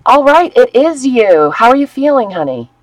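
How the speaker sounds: bright, loud, high hello; light up at a returning friend; excited greeting. Real, not acted. Greeting a sick viewer.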